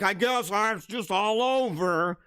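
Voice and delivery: slow, hammed-up self-pity whine; mock pity party. Mocking his own self-pity, mock mopey whine. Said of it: "boo-hoo pity party".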